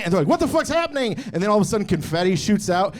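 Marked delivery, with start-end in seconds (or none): 0.3-1.1 high-pitched startled cry, acted; mock alarm. Package thief as the confetti trap goes off, mock alarm. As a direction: imitate someone caught by surprise.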